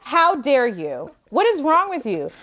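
retold outrage, pitched high, words stretched out; indignant scolding. Retelling her angry confrontation.

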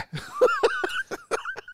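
squealing laugh, very high. Real, not acted.

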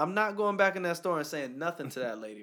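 high, loud refusal; indignant outburst. Retold or imitated. Telling a friend how he refused God, a loud high protest. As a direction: protest loudly that you won't apologize.

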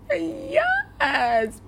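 high, thin, drawn-out squeal; mock ecstatic squeak; acted ecstatic yes. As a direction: squeal it softly and long. Acting out ecstatic reaction.

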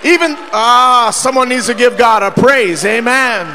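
loud, fervent preacher cadence; preaching at full force. Acted. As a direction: preach it out to the congregation.

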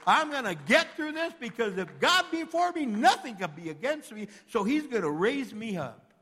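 loud, high, ringing preacher's cry; full-force preaching. Acted. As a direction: preach it out to the whole church.

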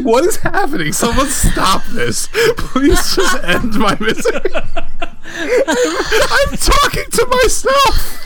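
high, laughing wail; helpless laughter. Real, not acted. Host cracking up at talking to himself, helpless high laughter. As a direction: plead through uncontrollable laughter. Cracks up at his own bit.

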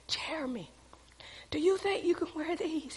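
soft, whispery question to her son; barely voiced, retold motherly murmur; retold hushed aside. Mother discreetly asks son in store.

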